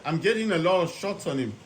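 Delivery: ordinary talk, nothing marked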